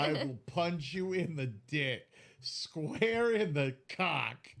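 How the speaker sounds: slow, drawn-out joking threat; slow mock threat. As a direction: stretch each word out.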